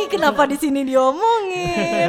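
high, loud, drawn-out complaint breaking into laughter; playful loud protest. Real, not acted. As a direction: stretch the complaint out loudly. Protests secret being revealed on air. (protes keras memanjang sambil tertawa)

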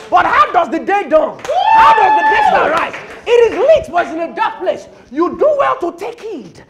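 loud, high, rhythmic preaching cry; full-force preaching. Acted. Preacher urging congregation to heed the light, full-force preaching. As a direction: build by repetition, crying out each phrase.

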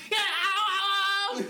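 loud, high comic howling; hammed-up cries of pain; mock pained yell. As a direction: imitate someone yelping from shocks. Imitates film character tasing himself.

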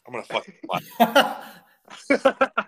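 high, slow laughing line. Real, not acted. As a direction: laugh through the words. Laughing confession.

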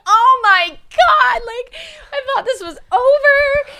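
high, loud, drawn-out mock wail; despairing wail. Sick traveller overwhelmed by nosebleed, despairing wail.

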